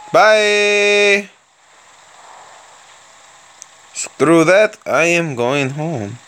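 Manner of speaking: hammed-up mock Russian accent held through the commentary. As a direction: play a cheerful Soviet tank commander with a thick Russian accent. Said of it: "I like doing that accent".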